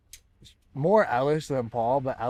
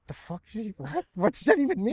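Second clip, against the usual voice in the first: baffled retort; 9 semitones higher than usual. Retold or imitated. Retold confusion over slang word.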